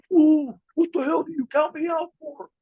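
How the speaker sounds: high mock yell at the referee. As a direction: squawk it like an angry wrestler. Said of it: "screaming and yelling at Red Shoes".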